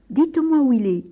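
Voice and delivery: loud, high order; exasperated father losing patience; angry demand. Acted. Angry father presses the priest.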